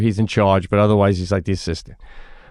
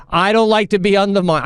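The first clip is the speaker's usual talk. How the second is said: high, indignant mock refusal; imitated officious coach; 10 semitones higher than usual. Coach refusing a dad's request, mock officious indignation. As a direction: assert authority in a pitched-up voice. Coach cutting in, acted quarrel.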